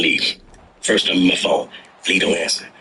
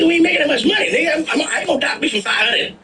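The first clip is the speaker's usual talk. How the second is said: mock protest; much faster than usual. Protesting the docking rule.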